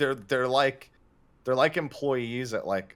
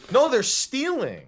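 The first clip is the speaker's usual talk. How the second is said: mock outrage; 9 dB louder, slower than usual. Joking rant about lazy mammoths.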